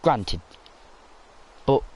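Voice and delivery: ordinary talk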